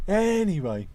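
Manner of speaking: high, loud, drawn-out anyway; loud sing-song anyway. Pretend. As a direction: belt out the anyway to change topic. High comic reset again.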